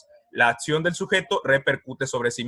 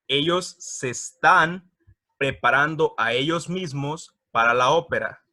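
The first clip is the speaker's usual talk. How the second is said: slow, stretched "Ellos se están"; slow emphasis; 3 dB louder than usual. Shown as a demonstration. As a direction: draw out the words to stress them. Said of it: "slow translation emphasis".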